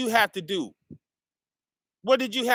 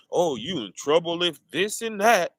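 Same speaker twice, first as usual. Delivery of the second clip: usual speaking voice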